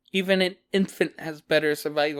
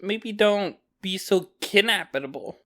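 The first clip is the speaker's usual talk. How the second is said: normal talking voice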